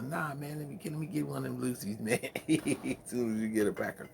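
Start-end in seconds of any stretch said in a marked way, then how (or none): 0.0-2.2 begging for a cigarette. Retold or imitated. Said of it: "everybody with their fingers talking about".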